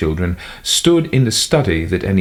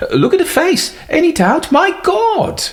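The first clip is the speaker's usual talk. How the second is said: much higher, aghast at the face; horrified cry; 3 dB louder than usual. A narrator's reading. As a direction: recoil in horror out loud. Butler horrified at the corpse.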